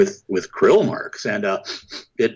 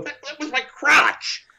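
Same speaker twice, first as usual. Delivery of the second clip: squeaky outrage. Pretend. After being bitten.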